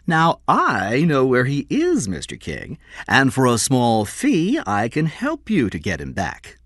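high, slow salesman's pitch; crooked dealer Score. Acted. Score offers to help King for a fee, high wheedling voice.

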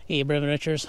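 ordinary delivery

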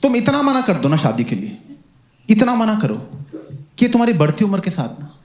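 normal talking voice

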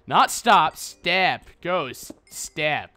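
"step" with a clear short e, not "stop"; stressing step. Shown as a demonstration. Telling viewers what to type, stressing "step" against "stop". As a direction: spell out "ghost" then "step" word by word. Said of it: "type ghost step".

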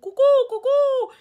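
high, loud cuckoo call; loud birdlike cuckoo hoots. Pretend. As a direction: sing out like a cuckoo bird. Imitating a cuckoo.

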